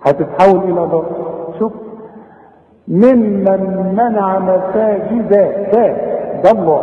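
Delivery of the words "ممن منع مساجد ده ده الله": ممن منع مساجد slow, the dal light, then الله full and heavy; thin the dal, thicken الله; light dal, heavy Allah. Shown as a demonstration. Teacher contrasts a light dal with the heavy name Allah. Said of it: "هرقق قوي الدال وفخم قوي لفظ الجلالة".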